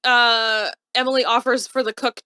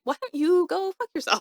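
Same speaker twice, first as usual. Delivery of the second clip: indignant retort; 8 semitones higher, 3 dB quieter than usual. Acted. Imagined retort to Emily.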